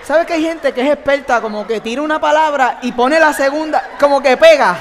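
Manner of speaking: bursting out with amused energy; amused exclaim. Real, not acted. A preacher joking to the crowd about expert swearers, excited and loud. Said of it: "laughing about swearing skill".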